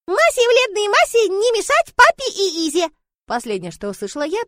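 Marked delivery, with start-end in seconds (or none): 0.1-2.9 put-on high, loud child's lisp; scolding little girl. Sonya telling dragons not to bother papa, childish scolding. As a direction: boss the dragons about in a childish squeal.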